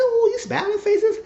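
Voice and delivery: reacting to the graffiti smiley in a mock voice; mock high squeal. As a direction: squeak it in disbelief. Speaker mocks the smiley face.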